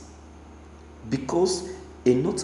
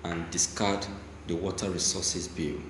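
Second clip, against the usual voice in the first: measured, low news-reader delivery; 5 semitones lower, 4 dB quieter than usual. Acted. Newscaster reading a Niger Delta protest statement, measured. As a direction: read the report steadily like a newscaster.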